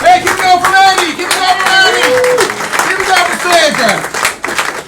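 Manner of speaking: high, loud call for applause; shouted stage hype; rousing the crowd. Real, not acted. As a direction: whip up a club audience.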